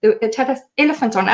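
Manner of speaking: normal talking voice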